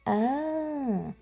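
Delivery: ordinary talk, nothing marked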